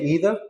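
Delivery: EITHER as "EE-ther"; American pronunciation. Shown as a demonstration. Teacher admits using the American one, EE pronunciation. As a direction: say EITHER with an EE start. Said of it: "I use an American one".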